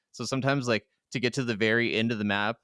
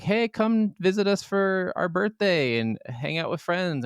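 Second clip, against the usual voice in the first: raised voice for inviting relatives; drawn-out, higher-pitched quoted invitation; retold relatives' invitation; 8 semitones higher, slower than usual.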